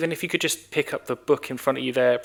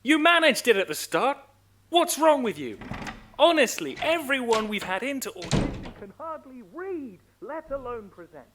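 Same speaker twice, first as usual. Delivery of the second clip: angry outburst; 12 semitones higher than usual. Acted. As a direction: snap in total frustration. Director loses temper at actor.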